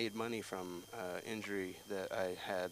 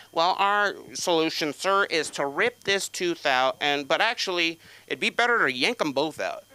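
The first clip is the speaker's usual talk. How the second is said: higher, deliberate mock-professional delivery; official hospital voice; 6 semitones higher, 11 dB louder than usual. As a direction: announce the plan with exaggerated formality. Hospital dentist announces the solution.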